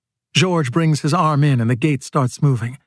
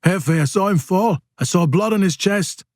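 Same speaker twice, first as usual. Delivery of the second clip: higher-pitched, urgent character voice; urgent report; 5 semitones higher than usual. A narrator's reading. Casper reporting to his boss what he saw, urgent.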